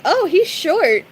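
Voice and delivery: high, slow mock surprise; high drawn-out remark; quoting her. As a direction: draw it out in a high voice. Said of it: "And she was like".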